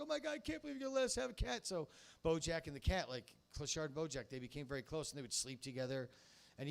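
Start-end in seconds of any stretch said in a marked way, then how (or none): 0.0-1.7 high, fast mock gushing; giddy rush, pitch way up; retold excited disbelief. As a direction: gush in a high put-on voice. Acting out his delighted disbelief.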